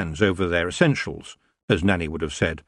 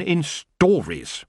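slow, scornful; contemptuous; 10 semitones higher, much slower than usual. A narrator's reading. Tiffany dismissing the elves, slow scorn. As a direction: spit the word with scorn. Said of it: "said the last word contemptuously".

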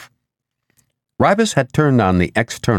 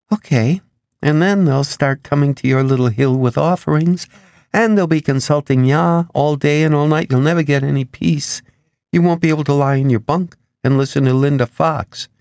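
higher-pitched, run-on warning; lightly voiced woman warning; 8 semitones higher than usual. A narrator's reading. Ribas warning Asher of endless pilgrims, lightly voiced. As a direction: keep piling on consequences in a lightly raised voice.

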